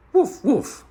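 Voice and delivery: high loud woof woof like a big friendly dog; dog barking. Acted. Narrator voicing the dog to Meddle, a loud high bark. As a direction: bark it out brightly. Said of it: "said the dog".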